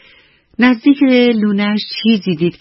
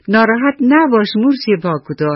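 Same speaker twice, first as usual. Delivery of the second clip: higher-pitched, slightly louder narrated call; mildly raised voice; 6 semitones higher, 3 dB louder than usual. A narrator's reading. Ants comforting their short-legged friend, mildly raised voice. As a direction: lightly voice ants calling out together. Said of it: "با صدای بلند گفتن".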